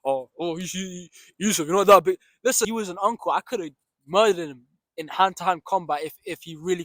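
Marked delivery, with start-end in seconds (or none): 0.1-2.2 mocking critic. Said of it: "trying to sound all hard".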